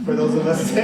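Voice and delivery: ordinary delivery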